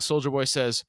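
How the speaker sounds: usual speaking voice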